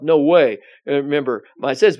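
ordinary talk

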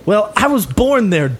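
high, put-on character voice; young gang leader. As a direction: explain it as a cocky young tough. Game master plays gang leader.

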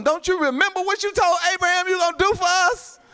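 high, insistent challenge to God; impassioned reminder of a promise; Moses pleading. Acted.

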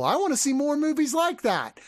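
put-on high, lilting quote of a young moviegoer; imagined kid. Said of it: "your kid is not gonna watch those and go".

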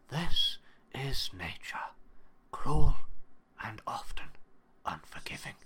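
hushed nature documentary whisper, with a sudden loud burst on cruel; dramatic whisper. Pretend. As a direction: whisper it like a wildlife narrator. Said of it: "that other part of my head was like".

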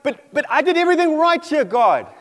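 high, loud plea to God; disappointed protest. Acted. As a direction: stammer into an aggrieved, acted complaint. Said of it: "he was disappointed".

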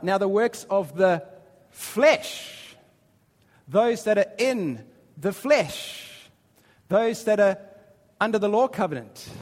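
slow, pressing preaching on each phrase; emphatic preacher; preaching emphasis. Acted. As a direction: drive each phrase home from the pulpit.

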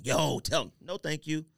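usual speaking voice

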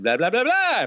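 high, fast blah-blah chatter; mock French rattling. Mocking the French lady's rapid reply. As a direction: rattle off gibberish like the clerk. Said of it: "looked at me and said".

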